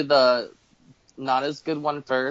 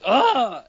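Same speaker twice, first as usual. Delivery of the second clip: loud disgusted ugh; 9 semitones higher, 6 dB louder than usual. Retold or imitated. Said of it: "made a very loud".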